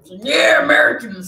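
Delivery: loud mock-American cheer; mock patriotic cheer. Co-host mimics people pushing their nationality, loud mock cheer.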